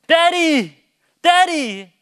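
high, loud mimicked call for a parent; drawn-out falling yell, imitating a son; imitated child calling. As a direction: call out like an insistent little kid. Imitates his son calling, silly reason.

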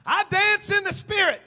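high, loud proud insistence; mock worshipper. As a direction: play the proud dancer answering back. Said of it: "Why are your calves sore?".

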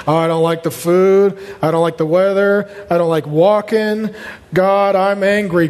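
droning, even-pitched list of gripes; hammed-up griping; mock whining. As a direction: grumble like a sulky complainer. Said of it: "When you think of grumbling, complaining, whining".

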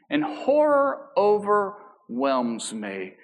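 slow, solemn psalm reading; scripture reading. Acted. Pastor reading Psalm fifty-five to the congregation, slow and solemn. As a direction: read each plea slowly, with reverence.

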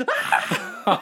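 sudden high, loud mock scream; prankster's short, piercing yelp; playful scream. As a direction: shriek to make someone jump. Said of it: "I would, like, scream".

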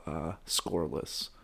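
usual speaking voice

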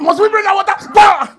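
high, loud angry roar at the rebels; furious shout. Acted. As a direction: bellow it at the crowd. Moses strikes the rock.

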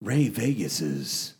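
big booming host announcement, drawn out; announcer intro. Pretend. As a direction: announce it like a ringmaster.

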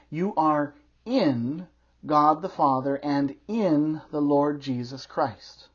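"in" stretched and hit hard; slow down and lean on each "in"; stress on IN. Real, not acted. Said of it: "I emphasize the word "in".

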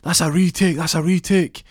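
high, loud referee shout; referee call. Retold or imitated. Referee stopping the clock, loud call. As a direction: call it out across the court. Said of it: "he's like".

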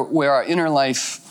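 ordinary delivery

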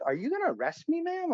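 very high-pitched mock indignation; retold indignant challenge. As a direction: challenge the cop, voice shooting up high. Retells talking back to yelling cop.

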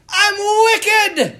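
high, loud Irish holler; Irish accent. Retold or imitated. Imitating an Irish priest answering his students as he walks, Irish accent. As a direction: cry it out in a hammed-up Irish accent. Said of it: "this deep Irish accent".

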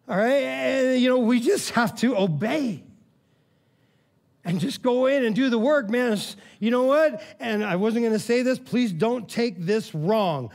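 energetic, high-pitched exhortation; fired-up preaching. Real, not acted. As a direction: press the congregation with a lifted voice. Preaches at full force.

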